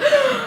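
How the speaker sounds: a high, sudden gasp of shock; sharp gasp. Retold or imitated. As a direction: suck in air as if you just saw the bag is gone. Shocked at the missing bag.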